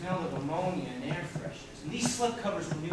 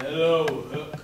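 low, a bit louder greeting; lightly voiced gruff greeting; deeper window voice; 3 semitones lower, 6 dB louder than usual. Butcher shop window greeting the boy, low and lightly gruff. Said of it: "The butcher shop window said".

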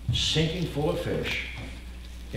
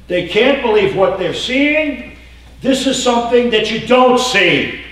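excited preaching; 8 semitones higher, 8 dB louder than usual. Real, not acted. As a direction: proclaim it with wonder. Preacher peaks on astonishment.

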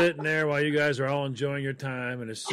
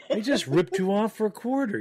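first take, laughing; 8 semitones higher than usual. Retold or imitated. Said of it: "I'm like".

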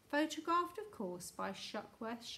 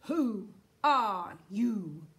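very slow, loud, word-by-word boom; booming demand; 10 dB louder, much slower than usual. A narrator's reading. Voice from Houston challenges the strangers, booming slowly. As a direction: boom each word out like a loudspeaker. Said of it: "boomed the voice from Houston".

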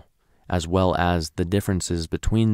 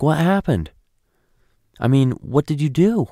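higher, louder, worried pressing; anxious wife; 8 semitones higher, 4 dB louder than usual. Retold or imitated. Wife pressing her silent husband, higher and anxious. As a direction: push him for answers, getting uneasy.

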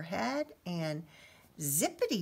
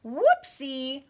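laughing whoopsie; 7 semitones higher, 7 dB louder than usual. A narrator's reading. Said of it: "Little Red laughed".

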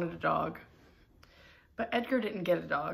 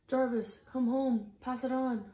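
higher calling voice; 6 semitones higher than usual. Acted. Message passed along, loud voice.

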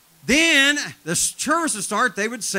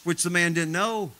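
normal talking voice

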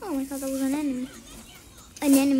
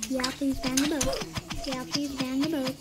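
usual speaking voice

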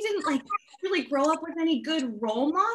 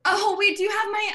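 raised, higher call after him; 6 semitones higher, 4 dB louder than usual. Acted. Michelle calls after the guy running back upstairs, raised. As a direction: call out to stop him leaving.

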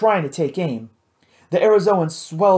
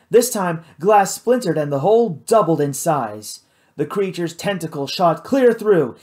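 ordinary delivery, nothing marked

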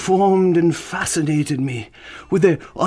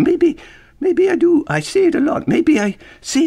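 much higher, squeaky voice, teasing himself; squeaky mock voice; 14 semitones higher, 3 dB louder than usual. Host teasing himself about his habit, high squeak. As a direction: jump way up into a silly squeak. Mock self-questioning aside.

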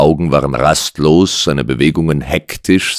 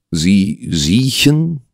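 questioning repeat; 4 semitones higher, much slower than usual. A narrator's reading. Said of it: "wiederholte Holmes fragend".

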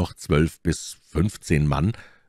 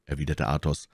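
ordinary delivery

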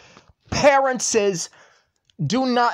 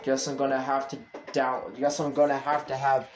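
defeated mumble; 6 semitones lower, 8 dB quieter than usual. Real, not acted. Resigned after fight with dad.

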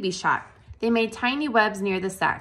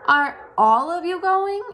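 high, slow worried pleading; anxious question; 9 semitones higher, 5 dB louder than usual. A narrator's reading. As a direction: ask fearing the answer. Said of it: "asked Wilbur".

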